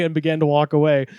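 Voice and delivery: ordinary talk, nothing marked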